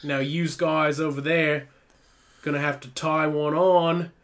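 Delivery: put-on a New Zealand sounding accent; bad Australian accent. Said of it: "I was trying to do Australian".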